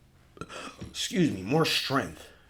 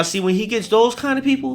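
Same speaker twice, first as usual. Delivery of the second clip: ordinary talk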